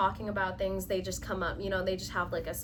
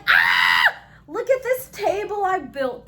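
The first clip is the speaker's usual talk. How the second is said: mock excited show-off; 6 semitones higher, 11 dB louder than usual. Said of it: "and I'm like".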